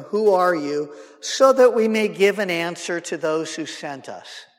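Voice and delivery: just get the answer and go; businesslike. Shown as a demonstration. Delegation demanding an answer from John, businesslike. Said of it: "That's not what verse twenty-two says".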